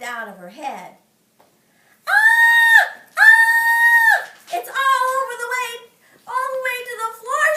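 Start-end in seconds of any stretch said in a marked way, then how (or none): 2.1-4.3 shrill high screams of dismay; shrieking. Acted. May screaming at her stretched sleeve, high and loud. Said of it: "she yelled". 4.5-7.5 high, loud wail; yelling. Acted. May yelling about her sleeve, high and loud. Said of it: "she yelled".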